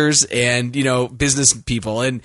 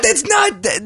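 shocked disbelief; slower than usual. Real, not acted. Disbelief at casting.

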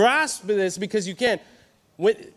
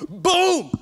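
door slam noise; 11 semitones higher, 4 dB louder than usual. Retold or imitated. Kicking the metal door.

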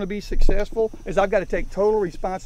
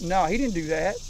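ordinary delivery

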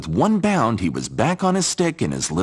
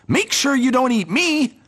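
much higher alarmed cry; alarmed scarecrow. Acted. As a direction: plead not to be eaten. Horses eat his bed.

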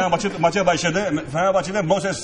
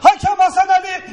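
excited commentary; 10 semitones higher than usual. Pretend. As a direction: build to a goal scream. Mock live play-by-play, goal.

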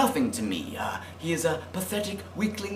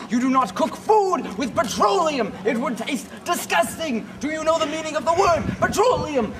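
higher, indignant scolding of a dumb customer; mock outraged yelling; 6 semitones higher than usual. Oilman scolding a customer who wants to cook with petroleum, outraged yelling. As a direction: berate him like he's an idiot. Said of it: "Please don't yell at me".

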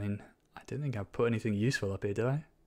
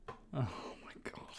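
slow unvoiced groan of words; exasperated whisper; 2 semitones higher, 11 dB quieter, much slower than usual. Real, not acted. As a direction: whisper it in disbelief.

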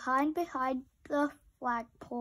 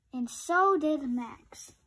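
ordinary delivery

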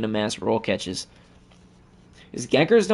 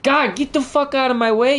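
high, loud screaming rage; furious shout; 13 semitones higher, 3 dB louder than usual. Real, not acted. Gamer screaming at a zombie blocking his path. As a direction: explode at the thing in your way.

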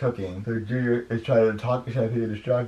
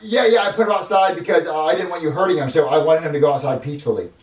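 high, loud, over-insistent character voice; eager, excusing kid character; 7 semitones higher, 6 dB louder than usual. Acted. Junior assures the chef the rat is gone, insistent. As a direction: insist on the cover story.

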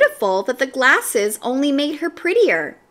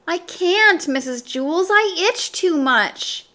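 put-on higher-pitched childish whine; whining; 7 semitones higher than usual. A narrator's reading. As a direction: plead like a kid who won't do her work. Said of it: "Dana whined".

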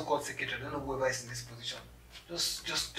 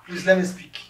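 raised, much louder plea; insistent interruption; 4 semitones higher, 12 dB louder than usual. Acted. Applicant silencing a companion who says thank you, insistent. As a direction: cut in forcefully over the hushing.

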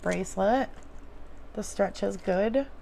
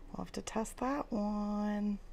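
whispered aside; 9 dB quieter than usual. Real, not acted.

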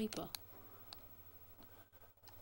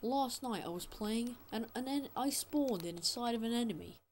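louder, higher, furious complaint; angry loud rant; 4 semitones higher, 5 dB louder than usual. Real, not acted. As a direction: yell it out at the developers. Angrily recounting spawn bug.

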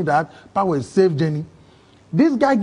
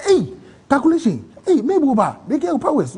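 surprised; 6 semitones higher than usual. Retold or imitated. Recognizing a friend in surprise.

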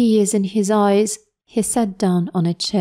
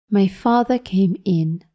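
ordinary delivery, nothing marked

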